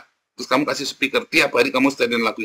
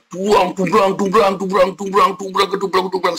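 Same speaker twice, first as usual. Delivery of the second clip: imitated drum clatter; 6 semitones higher than usual. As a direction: mimic clashing drums with your voice. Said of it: "coba kamu dengar".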